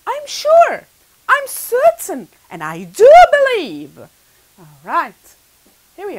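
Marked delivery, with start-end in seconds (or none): 0.1-4.0 "sure", "certain" and "do" each punched loud; loud, certain, "do" stretched for emphasis; emphatic conviction. Shown as a demonstration. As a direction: insist with rising force, landing hard on "do". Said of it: "that's not only I believe".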